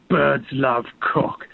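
slow, drawn-out orc-like growl of a line; lightly voiced Lord of the Rings orc; a hint of an orc, musing. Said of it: "in this kind of orc voice".

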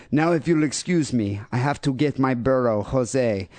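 hammed-up fake Brazilian accent; horrible Brazilian accent. Host embellishing a listener's email sign-off, bad Brazilian accent. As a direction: keep up the clumsy mock Brazilian accent. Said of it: "have to use this for the rest".

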